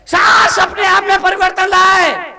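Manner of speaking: high, forceful sermon shout; preaching at full force. Real, not acted. Monk urging families to change themselves, full-force preaching.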